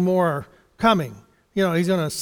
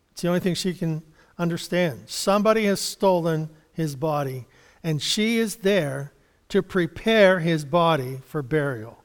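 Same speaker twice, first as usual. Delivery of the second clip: ordinary talk, nothing marked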